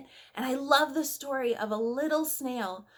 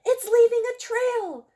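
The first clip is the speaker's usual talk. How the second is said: much higher, louder excited call; children exclaiming; 13 semitones higher, 8 dB louder than usual. Acted. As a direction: kids thrilled at the trail. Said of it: "say the children".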